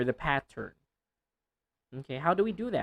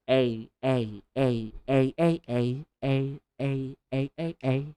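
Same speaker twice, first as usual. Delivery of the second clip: rapid "A, A" repetitions; quick vowel drill of A; faster drill; 3 semitones lower than usual. Shown as a demonstration. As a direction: speed up the A drill. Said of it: "and then faster".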